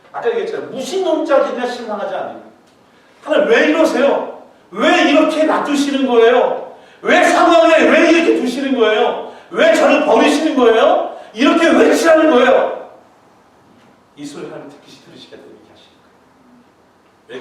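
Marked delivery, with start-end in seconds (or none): 3.2-12.9 high, loud, slow pleading lament; loud, slow, anguished complaint to God; crying out to God. Shown as a demonstration. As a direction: plead with God through hardship.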